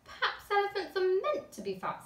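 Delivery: put-on a boy suggesting an idea; thoughtful child. A narrator's reading. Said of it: "said Luke".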